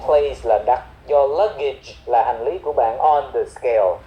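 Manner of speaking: usual speaking voice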